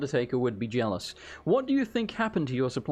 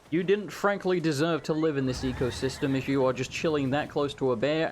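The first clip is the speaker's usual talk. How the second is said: normal talking voice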